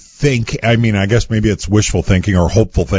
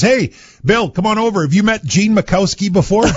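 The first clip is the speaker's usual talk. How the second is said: higher and eager, calling a friend over; a fan eagerly waving his buddy across the room; excited call; 8 semitones higher than usual. Retold or imitated. Praising fan calls his friend over to meet the chief, excited.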